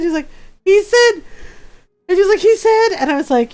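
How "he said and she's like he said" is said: high, louder, bursting to tell; a friend too giddy to finish her sentence; breathless excitement. Retold or imitated. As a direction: squeak it out, barely containing the news.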